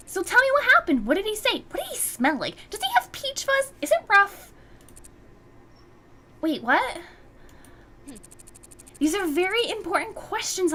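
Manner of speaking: high, breathless rapid questions; eager grilling. Acted. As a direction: pepper her with eager questions. Excited friend grilling about crush.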